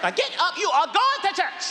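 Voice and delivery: high bossy mother voice; mama ordering. Retold or imitated. Mother ordering the kids up for church, high and bossy. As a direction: order them up high and firm.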